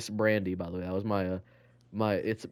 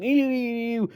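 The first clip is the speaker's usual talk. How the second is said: squeaky mock noise; 11 semitones higher than usual. Boy retelling his silly kindergarten prank, high squealed ew. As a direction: stretch it out in a high silly pitch.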